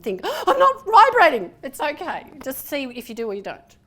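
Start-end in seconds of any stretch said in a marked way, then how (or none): 0.2-1.5 much higher and louder mock panic; performance-tense panic. Coach mocking a tense student who feels no buzz, mock panic. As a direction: squeal it like a nervous student. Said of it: "get up all performance tense".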